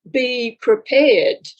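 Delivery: slow, weighty "Be prepared"; measured, portentous warning; solemn message. Retold or imitated. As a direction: intone "Be prepared" slowly like a voice from beyond. Spirit guides' message quoted.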